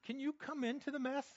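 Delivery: pleading prayer. Pretend. Praying to the Lord from the mess, pleading. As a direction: ask it like a desperate prayer. Preacher acts out a desperate prayer.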